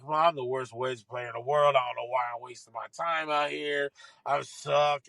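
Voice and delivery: low-pitched mock griping; bitching. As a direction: imitate a golfer moaning about himself. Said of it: "now he's bitching".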